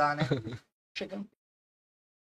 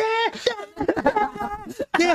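sleepwalking wife's cries; 12 semitones higher, much slower than usual. Retold or imitated. Husband imitating his sleepwalking wife crying out, high thin cries. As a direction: squeak it out like a half-asleep wife. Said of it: "dava os gritos".

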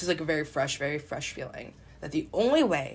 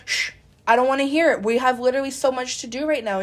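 loud, higher exasperated brush-off; dismissive; 6 semitones higher, 6 dB louder than usual. Retold or imitated. Adult self too busy for inner child, dismissive. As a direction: shush and push the nagging child away. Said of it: "And I'm like".

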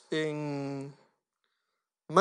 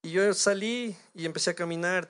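ordinary talk, nothing marked

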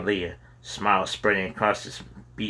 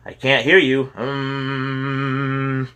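drowning him out; 5 semitones higher, much slower than usual. A narrator's reading. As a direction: hum over him, then shout the words. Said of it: "Kyle drowned him out".

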